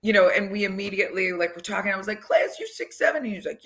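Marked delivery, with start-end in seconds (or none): 2.2-3.2 retold question pitched way up, quick; high-pitched amazement. Coach marvelling at a player's height, high-pitched amazement. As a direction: imitate your own amazed, squeaky question.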